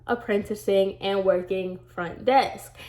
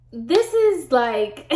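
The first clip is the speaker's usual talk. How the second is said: laughing; 7 semitones higher, much slower than usual. Real, not acted. Laughing about the awful salon.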